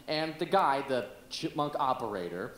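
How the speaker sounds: normal talking voice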